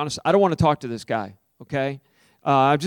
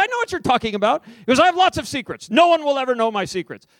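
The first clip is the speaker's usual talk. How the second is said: high-pitched defiant taxi driver; defiant boast; 9 semitones higher than usual. Retold or imitated. Preacher playing the taxi driver, high and defiant. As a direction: toss it back smugly and proudly.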